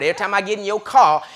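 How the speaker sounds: loud, high sermon with laughs; preaching at full force. Acted. Preacher telling the rider off about the tapes, loud.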